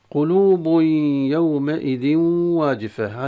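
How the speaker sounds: يومئذ واجفة with the tanween merged into و with ghunna, slow; nasal merging. Shown as a demonstration. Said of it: "هذا مثال لإدغام التنوين".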